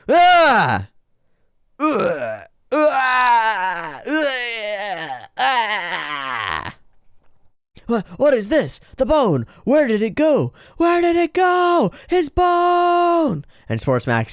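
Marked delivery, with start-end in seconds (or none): 0.1-6.7 shrill panicked cries; high startled screams. Acted. Screams as bone vanishes. 7.9-10.5 frantic high voice. Acted. Frantic search for bone. 10.8-13.4 shrieked even higher and slower; frantic shrieking. Acted.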